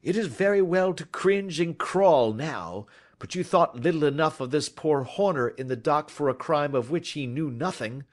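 firm, understated scolding; stern reproach. A narrator's reading. Detective rebukes cringing culprit about the framed plumber, stern. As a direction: rebuke him with cool contempt. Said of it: "said Holmes sternly".

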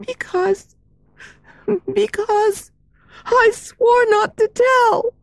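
high, hushed, near tears; fearful girl hesitating. Acted. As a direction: falter as if about to cry. Meg torn over secret.

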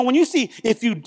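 ordinary talk, nothing marked

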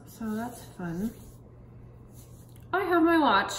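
lips framed by wet sheet; careful stiff-faced speech; talking through a face mask. Real, not acted. Seen 0: Wet sheet mask covers the whole face throughout, with an open mouth hole.

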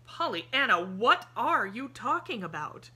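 loud, startled man, lost for sense; a confused man asking out loud; bewildered. A narrator's reading.

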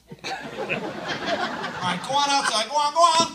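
calling the dog outside, loud; loud shooing. Acted. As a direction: shoo the dog out.

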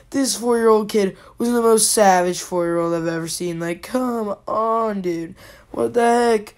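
ordinary delivery

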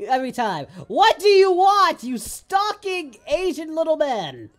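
mock angry yelling. Real, not acted. Gamer yells at a game character that keeps following him, exasperated. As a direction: shout at the pest like he's stalking you.

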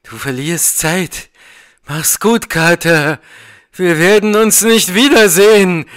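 building to a high, loud cry; rising to scream. A narrator's reading. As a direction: swell from restrained voice into a desperate farewell shout. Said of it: "Warrens Flüstern zu einem Schrei". (zum lauten Schrei anschwellend)

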